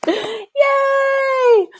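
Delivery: high, drawn-out cheer; delighted cheer. Real, not acted. Streamer cheering that Susan did it, delighted. Cheering a viewer who appeared.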